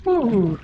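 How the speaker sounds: sharp ooh at the icy water; cold gasp. Real, not acted. First touch of icy water.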